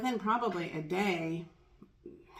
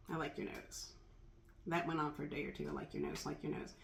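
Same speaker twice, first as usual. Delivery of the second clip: soft affirmation; 3 semitones lower, 9 dB quieter, faster than usual. Shown as a demonstration.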